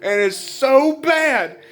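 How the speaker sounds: very high, slow, drawn-out wail; distraught wail. Retold or imitated. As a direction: stretch "so bad" high and anguished. Still voicing the screaming breakdown.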